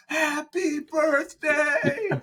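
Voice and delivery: very high, slow, drawn-out shout; kid screaming. Retold or imitated. Toddler on a bench yelling happy birthday at strangers, very high and slow.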